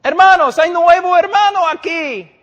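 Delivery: high, loud hammed-up hallelujah; mock a pastor gushing to the church; overexcited pastor. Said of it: "Y el pastor dice".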